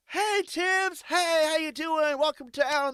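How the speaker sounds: high, loud, hearty greeting called out; friendly townsfolk. Retold or imitated. Townsfolk welcoming the newcomer Tibbs, high cheery shout. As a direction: hail the newcomer like an overeager local. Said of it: "They're like".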